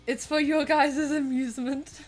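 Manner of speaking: usual speaking voice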